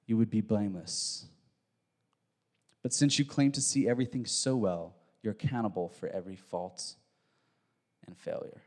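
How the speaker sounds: low, soft pronouncement, trailing into quiet; solemn scripture reading. Acted. Reads Jesus' closing verdict.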